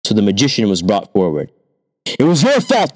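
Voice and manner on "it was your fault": accusing king. A narrator's reading.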